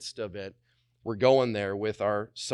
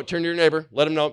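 ordinary talk, nothing marked

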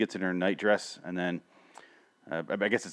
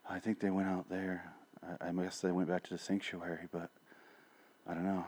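very soft, near-whispered mumble; whispering savior; 4 semitones lower, 13 dB quieter than usual. Retold or imitated. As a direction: barely breathe the words out. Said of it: "I call him the Whispering Savior".

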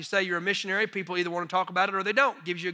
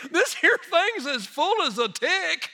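slow, high Tennessee country drawl; rural Tennessee man; 9 semitones higher, slower than usual. Retold or imitated. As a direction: stretch out the imitated Tennessee twang for laughs. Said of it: "exact quote of what he said".

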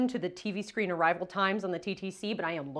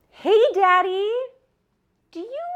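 much higher, sugary "Hey, Daddy"; sweet daughter voice; 12 dB louder than usual. Retold or imitated. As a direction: coax dad sweetly, high. Phoning dad, sweet voice.

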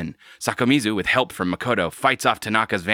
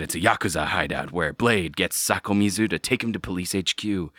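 ordinary talk, nothing marked

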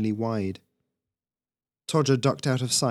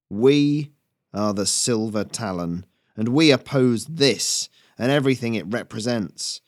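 loud, emphatic oratory; rallying speech; 5 dB louder than usual. Acted. Rebel leader recruiting human workers, emphatic rallying speech. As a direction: proclaim it in deliberate, punched phrases.

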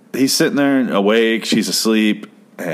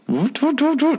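high squeaky printer voot voot; chirpy machine noise voot voot. Retold or imitated. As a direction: mimic a little ticket printer beeping.

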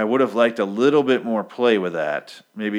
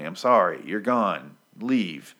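usual speaking voice

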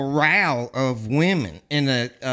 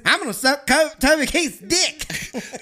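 mock groupie; 9 semitones higher than usual. Mocking the backstage groupies in a high girly voice. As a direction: sneer it out like a giddy groupie.

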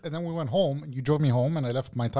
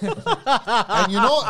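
laughing; 8 semitones higher, 6 dB louder, much slower than usual. Real, not acted. Teasing bro about blowjob.

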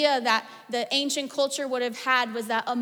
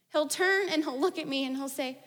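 ordinary talk, nothing marked

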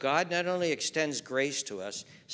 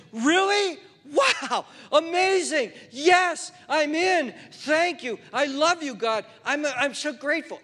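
high, loud, gushing thanks; overjoyed; 10 semitones higher, 7 dB louder than usual. Acted. New believer thanking God, high loud joyful gushing.

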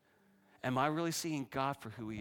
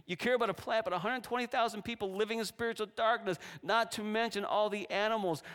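pleading God; 9 semitones higher than usual. Acted. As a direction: plead with Jonah, voice lifted high. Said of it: "God's saying".

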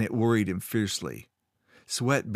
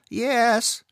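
high, louder frightened gasp of a yes; 14 semitones higher, 5 dB louder than usual. A narrator's reading. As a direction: blurt it out in fright, pitched up. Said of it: "Timble gasped".

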